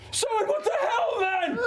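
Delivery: high, drawn-out indignant shout; outraged shout. Acted. Stabbed man to his attacker, outraged high shout. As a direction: yell it slow and high, aggrieved.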